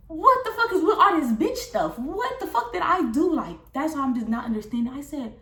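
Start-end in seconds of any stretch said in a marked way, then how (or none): 0.1-3.3 high and loud, slowing on the last question; exasperated demand. Retold or imitated. Calling Kamaya out on the phone, high and loud. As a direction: demand an answer, fed up.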